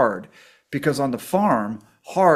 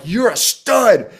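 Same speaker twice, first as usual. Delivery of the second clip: admiring; 8 semitones higher, 6 dB louder, much slower than usual. Retold or imitated. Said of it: "I'm like".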